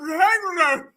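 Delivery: hammed-up angry growl; mock growling. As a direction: growl the words, mimicking an angry man. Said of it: "He out there growling".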